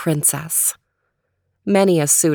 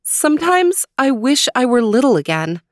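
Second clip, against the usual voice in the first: wistful princess; 6 semitones higher, 3 dB louder than usual. Acted. Princess voiced in story.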